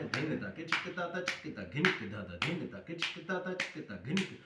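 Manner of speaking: quick, run-together spoken bols; fast rhythm recitation. Shown as a demonstration. Teacher showing how the rhythm goes at speed, fast recitation. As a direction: rattle off the drum syllables. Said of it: "तेज भी बजाएंगे तो".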